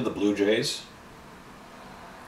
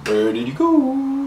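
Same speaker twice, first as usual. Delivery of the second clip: playful high call; slower than usual. Real, not acted. Calling out for lost brush cover.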